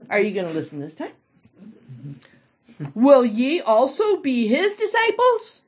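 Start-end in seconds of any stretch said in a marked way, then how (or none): 2.9-5.6 slower, louder, higher teasing question; sarcastic jab. Acted. Healed man teasing the Pharisees, sarcastic and slow. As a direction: needle them with mock innocence.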